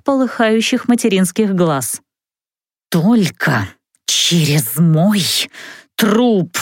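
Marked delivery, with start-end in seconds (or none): 2.9-6.6 slow, low hissing threat that breaks off on "труп"; inhuman hiss. Acted. Mother hisses at the mage who claims her daughter, slow and inhuman. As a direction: hiss it like something not quite human. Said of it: "почти нечеловеческое шипение".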